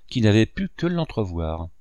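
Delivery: ordinary delivery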